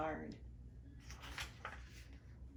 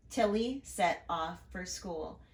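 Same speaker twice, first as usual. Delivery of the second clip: plain reading voice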